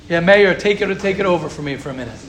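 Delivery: ordinary talk, nothing marked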